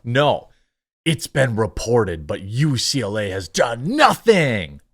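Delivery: ordinary delivery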